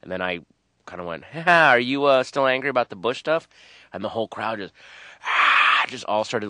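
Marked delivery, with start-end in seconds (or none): 1.2-3.5 higher, louder retort with a mock laugh; taunting comeback. Comic goading a hostile crowd after a heckle, loud taunt. 5.2-5.8 loud, toneless mock roar; imitated crowd scream. Retelling the whole crowd's uproar, mock scream. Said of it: "screaming and pounding their tables".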